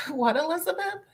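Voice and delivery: laughing, high-pitched disbelief; incredulous question breaking into giggles. Real, not acted. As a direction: ask it through real laughter. Cracking up at a chat message.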